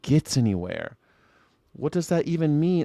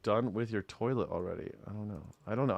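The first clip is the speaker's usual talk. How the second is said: ordinary talk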